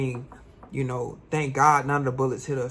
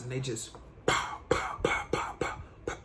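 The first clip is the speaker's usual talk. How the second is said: whispered gunshots; 7 dB quieter than usual. Retold or imitated. Imitating gunshots.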